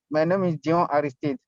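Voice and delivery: usual speaking voice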